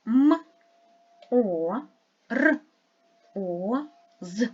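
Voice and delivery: "М, О, Р, О, З" said as separate sounds with pauses between; sounding out phonemes. Shown as a demonstration. Teacher sounding out each sound of the word. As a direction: sound out "М", "О", "Р", "О", "З" one at a time. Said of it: "послідовно назвіть усі звуки".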